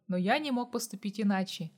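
ordinary delivery

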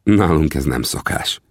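usual speaking voice

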